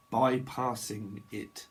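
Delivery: "Bypassing" stretched out slowly, syllable by syllable; slow, separated syllables on "Bypassing it"; drawn out. Shown as a demonstration. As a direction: say "Bypassing it" at half speed. Same word again, drawn out.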